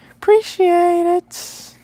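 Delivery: very high, slow squeaky thanks. Shown as a demonstration. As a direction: squeak it out slowly like a cartoon. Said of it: "high squeaky repeat".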